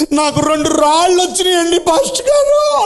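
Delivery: put-on high, wailing little boy; crying child. A narrator's reading. Boy tells pastor he got stones, wailing. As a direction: whimper it like a kid in tears. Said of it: "ఒకడు మాత్రం ఏడుస్తున్నాడు".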